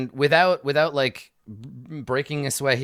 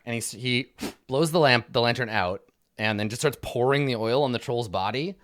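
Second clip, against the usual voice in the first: ordinary delivery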